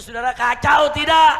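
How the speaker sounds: high, loud question to a crowd; rousing shout. Real, not acted. Preacher demanding congregation's verdict on officials, rousing shout. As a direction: shout for their agreement.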